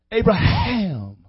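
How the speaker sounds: "Abraham" drawn out slowly on a breathy H; breathed H. Shown as a demonstration. Preacher showing the breath in a new name, slow breathed "Abraham". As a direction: stretch "Abraham", pushing breath through the H. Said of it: "you cannot make the H sound without".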